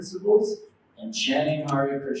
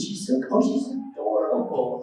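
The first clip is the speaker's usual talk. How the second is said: higher-pitched cooing, mock adoration; gushing over baby; 6 semitones higher than usual. Everyone admires the new baby, gushing. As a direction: coo over a cute baby. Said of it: "Everyone looks at us and goes".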